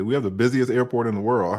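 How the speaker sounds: ordinary delivery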